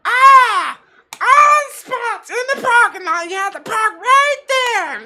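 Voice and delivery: high-pitched, full-volume screech; imitated yelling. Driver raging at him over a parking spot, imitated yelling. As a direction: yell it at the top of your lungs, dragged out. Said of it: "yelling at the top of her fucking lungs".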